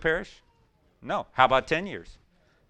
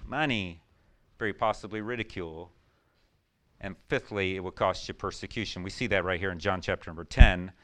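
ordinary talk, nothing marked

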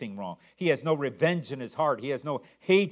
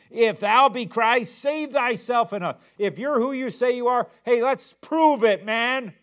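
high, loud jeering criminal; a scoffing dare, pitched high and loud; mocking taunt; 9 semitones higher, 6 dB louder than usual. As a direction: sneer it up at him like a taunting thief. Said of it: "continues with his railing".

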